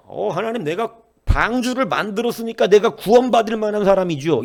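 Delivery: a high, loud, puffed-up boast to God, with 구원받을 pushed hardest; smug boast. Pretend. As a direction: crow about having earned salvation. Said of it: "이렇게 말을 했다면". (높고 크게 뻐기는 말투)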